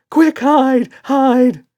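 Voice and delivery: panicked shout. A narrator's reading. Red begs to hide from the dad, frantic shriek. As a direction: shriek for cover.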